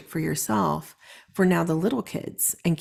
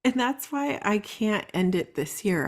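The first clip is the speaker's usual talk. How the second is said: normal talking voice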